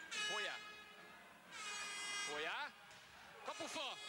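excited hungarian sports commentary. Real, not acted. Live call of a shot hitting post.

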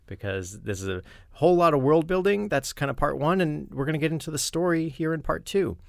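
lively show-opening announcer read; upbeat podcast host intro. Acted. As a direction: welcome the ghosts like a host.

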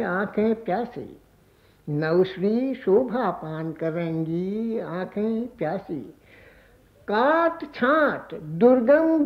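measured, lilting verse recital with drawn-out line ends; poem recitation. Acted. Poet presenting new songs to listeners, lilting poem recitation. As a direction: recite verse, repeating lines with swelling pitch.

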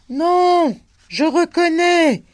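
high, loud yell; young man yells back over the wind. A narrator's reading. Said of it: "hurla le jeune homme".

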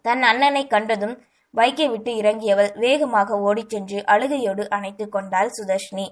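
plain reading voice

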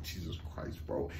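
breathy stunned whisper. Retold or imitated. Watching Rue go off, stunned whisper. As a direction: whisper it in disbelief. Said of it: "I'm just like".

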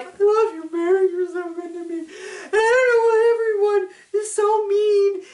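high, sobbing mock whine, slowing down at the end; mock crying Robin. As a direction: imitate him fake-crying and half singing it. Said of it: "Robin's like the whole".

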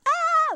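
high, forced sung "Ah"; pushed high note demo. Shown as a demonstration. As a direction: belt a strained "Ah" to show pushing. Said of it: "She didn't push it too much, like".

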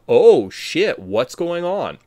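a loud, startled "oh shit"; shocked viewer. Retold or imitated. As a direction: blurt it out like a stunned fan. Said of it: "you're like".

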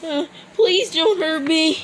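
high, crying plea for mercy; beg, whimpering with fear; terrified horror victim. Acted. Pleading with the zombie.

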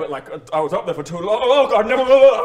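loud, breathless groaning about feeling sick; queasy showman. Acted. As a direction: ham up the nausea after the act. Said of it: "And then I go".

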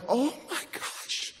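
slow, breathless whispered disbelief; stunned whisper. Retold or imitated. Seeing the healed girl at his door, stunned whisper. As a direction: whisper it, overwhelmed. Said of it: "And I'm like".

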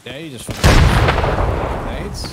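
loud complaint. Real, not acted. Complaining about grenades.

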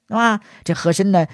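ordinary delivery, nothing marked

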